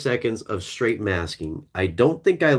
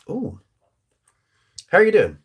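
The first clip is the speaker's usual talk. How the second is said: playful greeting; 10 semitones higher, 5 dB louder than usual. Pretend. Greets imagined viewer playfully.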